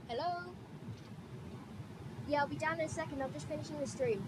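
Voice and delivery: talking away from the mic; distant voice, turned away. Real, not acted. Answering someone off-mic in house.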